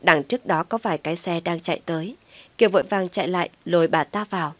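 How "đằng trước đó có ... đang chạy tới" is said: plain reading voice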